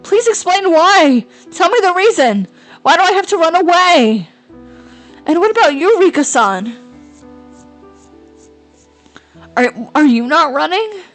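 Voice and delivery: frantic shouted pleading. Acted. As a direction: demand answers in a panic. Friend demands answers, panicked.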